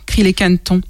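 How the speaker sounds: ordinary delivery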